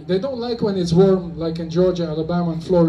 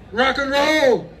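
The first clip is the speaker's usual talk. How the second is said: rallying call; 7 semitones higher, slower than usual. Real, not acted. Leads audience to shout for film.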